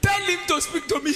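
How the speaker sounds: high, loud mock protest; indignant retort. Wife answering husband about God's threat, indignant yell. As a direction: protest it loudly with laughter around it.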